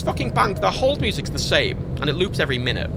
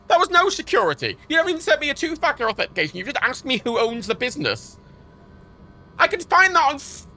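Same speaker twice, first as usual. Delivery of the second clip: high, indignant protest; worked-up retold outrage; exasperated rant; 8 semitones higher than usual. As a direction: argue in rising disbelief at the nonsense. Ranting about lax bank security.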